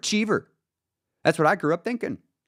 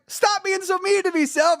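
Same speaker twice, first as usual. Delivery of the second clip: high-pitched plea to himself; pleading protest. Acted. Man begging his own mind to be kinder, pleading protest. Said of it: "I'd have been like".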